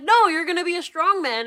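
high, loud mock mother; bossy mom voice. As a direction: insist like a pushy mother. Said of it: "She's like".

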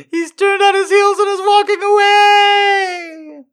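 high squeal through laughter; cracking up. Real, not acted. Reader losing it over the story, high laughing squeal. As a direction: squeal the line out while losing it.

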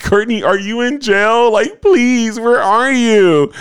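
very high, hammed-up pleading; mock drunken wail. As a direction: beg in a squeaky, drawn-out fake cry. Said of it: "just crying and screaming".

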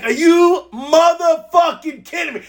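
high, slow, drawn-out disbelief; incredulous outburst. Retold or imitated. Recounting the mute mishap on a conference call, incredulous cry. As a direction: stretch out each word in amused, high-pitched disbelief.

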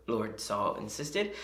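ordinary talk

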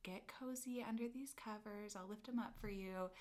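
soft, soothing therapist voice; soft spa voice. Retold or imitated. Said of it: "like, spa voice".

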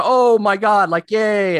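excited cheer. Retold or imitated. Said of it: "some people go".

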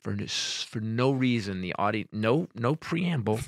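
normal talking voice

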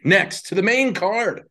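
announcer voice. Acted. Announcer build-up.

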